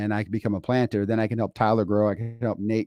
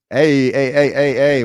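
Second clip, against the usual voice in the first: loud, chanting hey hey hey, pitched up; excited cheer; 4 semitones higher, 7 dB louder, slower than usual. Acted. Hyping up a Super Bowl champion with a loud chant. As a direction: cheer him on like a stadium crowd.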